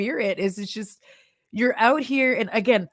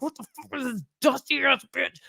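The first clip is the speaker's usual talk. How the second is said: a mock outraged outburst, pitched up; imitating her angry self; 6 semitones higher, 3 dB quieter than usual. Creator mocking her past angry self, mock outrage. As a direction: retell your own fed-up rant, half-joking. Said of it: "I'm legitimately getting, like, angrier and angrier".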